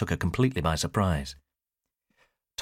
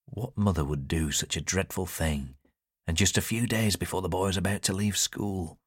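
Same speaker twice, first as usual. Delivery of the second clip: plain reading voice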